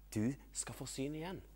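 soft, calm pronouncement; gentle reassurance. Acted. Jesus healing the blind man, soft reassurance. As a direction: answer softly and calmly.

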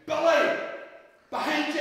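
high, loud call from a distance; big ringing shout. A narrator's reading. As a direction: shout the warning, high and urgent. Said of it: "he heard a voice shouting".

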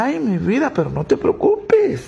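voz aguda de señora con risa; esposa pícara. Acted. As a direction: confiesa con picardía y risita. Esposa confiesa riéndose. (esposa pícara, aguda y riendo)

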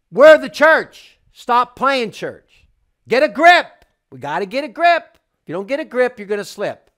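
preacher shouting a rebuke; fiery preaching. Acted. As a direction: hammer it home to the congregation. Preaching at full force.

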